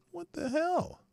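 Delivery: very high incredulous what the hell, rising at the end; what the hell squeaked up high as a question. Real, not acted. Repeated even higher.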